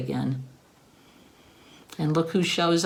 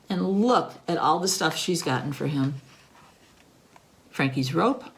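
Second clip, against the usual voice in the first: ordinary delivery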